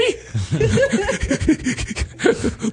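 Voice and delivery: high burst of laughter. Real, not acted. Laughing at his own puppy impression. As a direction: laugh at your own silly voice.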